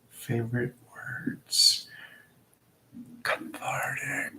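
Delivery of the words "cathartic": slow, unvoiced whisper. Savoring a favorite word for the viewers, slow whisper. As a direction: stretch it out in a hushed breath.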